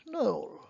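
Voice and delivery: Noel run together as one syllable, "nol"; English-style Noel. Retold or imitated. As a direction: say Noel as "nol", skipping the second vowel. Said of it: "I've heard people in English call it".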